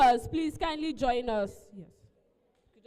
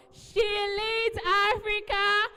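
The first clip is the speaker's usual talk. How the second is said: crowd shout; 8 semitones higher than usual. Real, not acted. MC leading the crowd in a shouted, high chant. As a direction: belt the name out with the room. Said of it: "we're going to shout".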